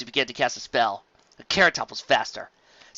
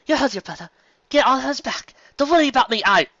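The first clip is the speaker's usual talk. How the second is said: higher, louder insistence; firm urging; 11 semitones higher, 6 dB louder than usual. Acted. Carrot Top urges Apple Bloom onto her brother. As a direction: send her on ahead.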